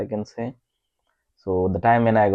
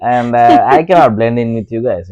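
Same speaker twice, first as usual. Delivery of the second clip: talking through a laugh, louder; 3 semitones higher, 10 dB louder than usual. Real, not acted. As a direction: laugh as you say it. Laughing after partner laughs.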